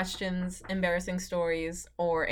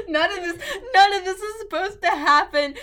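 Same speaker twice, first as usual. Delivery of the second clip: high, loud wailing; mock despair; 8 semitones higher, 8 dB louder than usual. Distraught outburst over game.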